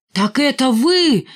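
slow, high-pitched roar of accusation; angry roar. A narrator's reading. Лохматый turns on Валька, roaring. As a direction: roar it out, dragging the words. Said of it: "взревел Лохматый".

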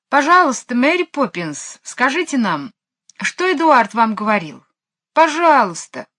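высоко и громко, умоляюще; умолять изо всех сил; мольба. Acted. Дети умоляют Мэри Поппинс. (дети умоляют Мэри Поппинс, высоко и громко)

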